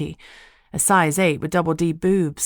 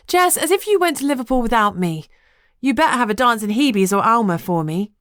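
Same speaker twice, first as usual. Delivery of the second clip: a touch higher, playful best friend teasing; lightly voiced Lucy; 6 semitones higher, 4 dB louder than usual. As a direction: tease her friend in a light, bright voice.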